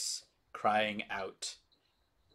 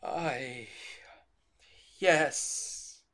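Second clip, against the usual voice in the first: anguished priest; 4 semitones higher, 6 dB louder, slower than usual. Acted. GM voices Donovich.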